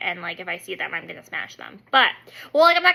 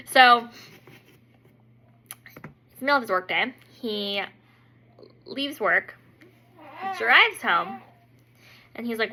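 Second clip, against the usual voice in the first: ordinary talk, nothing marked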